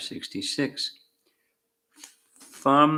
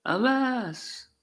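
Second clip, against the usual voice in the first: very high, drawn-out mock wail; crying out in lament; 9 semitones higher, much slower than usual. A narrator's reading. Villagers stumbling up bewildered to Krishna, a high lamenting cry. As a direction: wail each word high, without raising the volume. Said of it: "They too cried".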